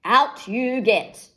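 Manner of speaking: slow, loud, gruff order; wolf ordering Beauty out of bed; snarled. A narrator's reading. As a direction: growl it out word by word. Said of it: "he snarled".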